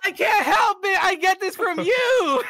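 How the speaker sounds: very high, loud protest; hammed-up yelling back at mom; acted exasperated yell. Acted shouted comeback to mom.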